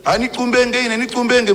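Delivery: high mock whine, hammed-up; a high, hammed-up parody of a false prophet; mocking a constipated prophet. Mocking voice resumes in Tumbuka.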